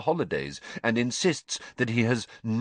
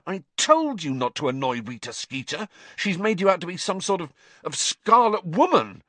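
rising into a high, louder scold; Ron scolding; 10 semitones higher, 4 dB louder than usual. A narrator's reading. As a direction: get worked up as the insult comes out. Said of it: "Ron hissed".

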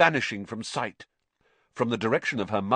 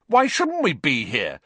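heated, louder, higher retort; hot; 6 semitones higher, 6 dB louder than usual. Acted. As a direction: snap back hotly. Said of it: "said Ron hotly".

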